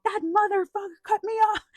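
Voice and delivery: playful mock outrage in traffic; mock road rage. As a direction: squeal it like a furious driver cut off. Angry driver in traffic.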